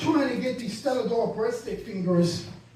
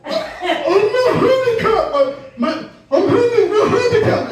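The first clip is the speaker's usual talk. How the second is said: indignant protest; 10 semitones higher, 8 dB louder than usual. Retold or imitated. Comic protesting to the airport agent, flustered and loud. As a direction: protest it, tripping over the words.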